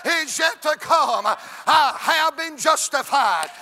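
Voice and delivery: loud chanted pulpit cadence, drawn out; full-force preaching. Acted. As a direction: build the crowd to a shout.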